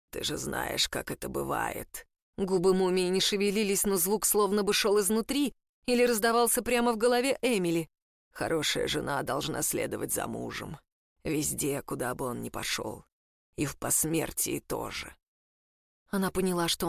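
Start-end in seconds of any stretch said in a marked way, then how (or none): 0.1-2.1 low, slow and soft rasp; eerie voice from inside her head; creaky mummy voice. A narrator's reading. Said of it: "Скрипучий голос мумии". 8.3-15.1 eerie voice from inside her head; creaky mummy voice. A narrator's reading. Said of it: "Скрипучий голос мумии".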